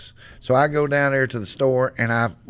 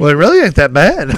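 loud defensive buddy; 3 semitones higher, 8 dB louder than usual. Retold or imitated. Buddy defending cat food taste.

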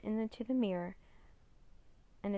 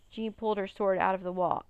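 usual speaking voice

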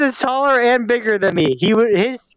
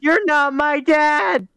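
slow, high mock protest; mock outraged; 6 semitones higher, much slower than usual.

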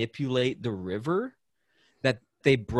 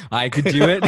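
laughing boast; 6 semitones higher, 6 dB louder than usual. Retold or imitated. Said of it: "I was like".

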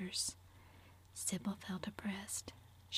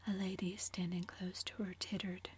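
usual speaking voice

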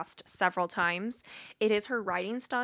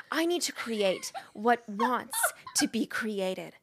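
Julia Cameron. Acted. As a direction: read it in character, stretching key words. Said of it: "read it like I think it's Julia Cameron".

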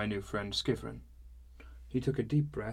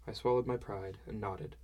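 ordinary delivery

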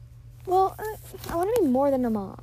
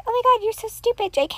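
higher, louder fake-mean teasing; mock mean friend; 11 semitones higher, 4 dB louder than usual. She imitates people who insult friends jokingly, higher and louder. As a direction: tease like a bratty friend, much higher. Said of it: "I'm like".

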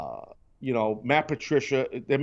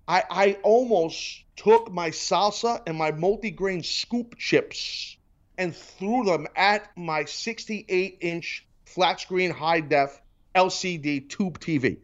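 ordinary delivery

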